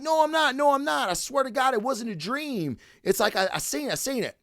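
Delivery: high-pitched imitation of a defensive child; insistent kid. Kid swearing to doubting friends he saw a monster, insistent kid imitation.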